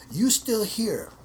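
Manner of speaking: put-on teacher brushing off student in a thick Chinese accent. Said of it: "in a real thick accent".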